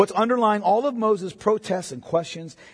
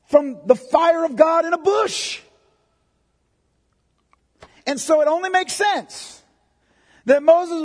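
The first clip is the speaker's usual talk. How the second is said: louder, higher fervent preaching; preaching at full force; 11 semitones higher, 7 dB louder than usual. Acted. Preacher proclaiming God's promises to Moses, fervent and loud. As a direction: build the sermon to a passionate peak.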